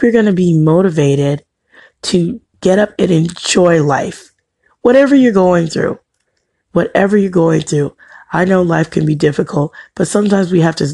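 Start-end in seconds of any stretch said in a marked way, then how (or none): 4.8-6.0 higher and louder, rousing; emphatic call. Acted. Speaker rallying viewers through hard times, raised emphatic call. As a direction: lift it up to grab the listener. Encouraging viewers, first of repeat.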